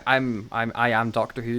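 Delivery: ordinary delivery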